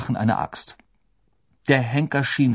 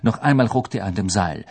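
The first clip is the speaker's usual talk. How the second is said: plain reading voice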